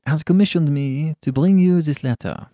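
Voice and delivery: put-on courteous old Frenchman, lightly accented; slight French accent. A narrator's reading. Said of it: "in a slight French accent".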